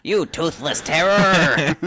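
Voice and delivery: slow, high, laughing taunt; mock villain taunt. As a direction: drag it out like a cartoon hero. Mocking the Skeletor bit.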